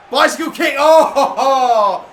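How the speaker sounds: loud, high, thrilled yell with a laugh; real excited whoop; excited shout. Real, not acted. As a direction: cheer it like a near wonder goal. Excited goal chance reaction.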